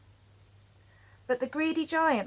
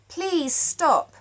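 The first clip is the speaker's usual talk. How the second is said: raised, pleading cry of please stop; 7 semitones higher, 6 dB louder than usual. A narrator's reading. Greta pleads with the giants to stop, raised cry. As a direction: call out to the giants, pleading hard. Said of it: "Greta cried".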